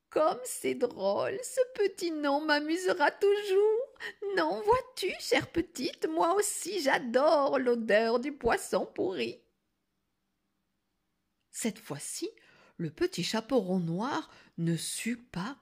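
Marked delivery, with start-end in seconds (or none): 0.1-9.4 very high, lilting granny voice; delighted grandmother. Acted. Grandmother answers the girl with sugary amusement. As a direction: coo with put-on amusement.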